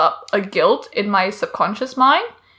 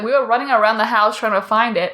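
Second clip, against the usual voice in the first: usual speaking voice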